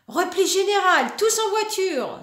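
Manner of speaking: loud, high rallying cry; dad calling a retreat, mock alarm; dad shouting. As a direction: order everyone back like a general. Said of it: "s'écrie papa".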